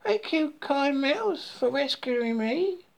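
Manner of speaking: high-pitched frog voice, full of thanks; high, happy little frog; gleeful frog. A narrator's reading. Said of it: "jumped with glee".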